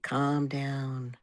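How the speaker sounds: normal talking voice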